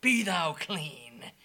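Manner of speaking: loud declaration easing to slow softness; Jesus healing. Acted. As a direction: call it out, then settle it gently. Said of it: "and saying".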